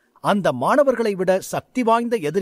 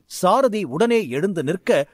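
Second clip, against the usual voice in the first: usual speaking voice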